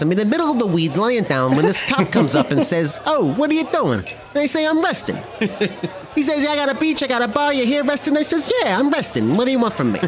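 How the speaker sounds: New Jersey wiseguy telling a cocky tale; animated tough-guy storyteller with a Jersey accent; Joe Pesci. Acted. Wiseguy recounting a run-in with a cop, Pesci-style bravado. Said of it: "You do that accent so well".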